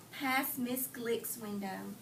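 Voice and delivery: ordinary delivery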